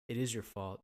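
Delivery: quoted deadpan retort. Retold or imitated. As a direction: drop the pun low and dry. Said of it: "Etchison just goes".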